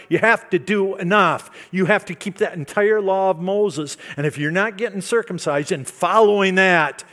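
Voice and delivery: loud, rising preacher voice hammering the demands; preaching at full force. Acted. Preacher voicing the teachers' demands, loud and forceful. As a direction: drive each demand home like a stern legalist.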